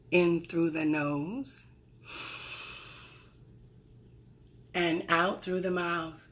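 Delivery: soft, unhurried meditation voice; guided breathing meditation. Acted. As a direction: gently lead them through each breath.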